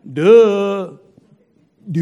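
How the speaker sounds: loud, dismissive mock grunt; dismissive duh. Husband brushing off his wife, loud dismissive duh.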